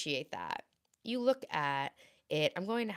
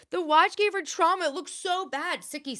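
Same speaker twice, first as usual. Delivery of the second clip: silly high voice; 11 semitones higher, 6 dB louder than usual. Acted. Reading chat in silly voice.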